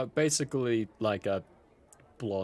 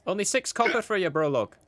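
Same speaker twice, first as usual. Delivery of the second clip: louder, higher cheery barkeep; Gideon; 8 semitones higher, 4 dB louder than usual. Acted. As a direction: repeat the price brightly over the laughter. Innkeeper repeats price over laughter.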